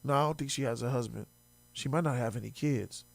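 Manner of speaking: low, soft and quick; muttered aside. Real, not acted. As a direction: throw it away under your breath. Muttered aside about her.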